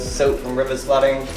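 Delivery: ordinary delivery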